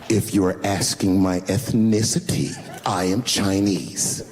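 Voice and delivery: slow, low, drawn-out condescension; condescending. Retold or imitated. Offended wife answering where they are from, condescending. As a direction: spell it out haughtily, word by word. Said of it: "she goes, very condescendingly".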